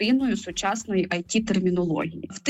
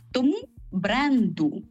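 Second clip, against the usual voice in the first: «Тому» виділено, повільно: «Тому бренду»; повільно підсумувати, наголос на «Тому»; emphatic conclusion; 3 semitones higher, slower than usual. Shown as a demonstration. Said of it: "загальних понять". (повільний підсумок «Тому бренду»)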